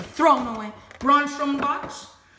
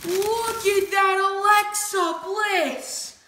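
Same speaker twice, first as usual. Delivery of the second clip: excited; 8 semitones higher, much slower than usual. Real, not acted. Showing off new figures.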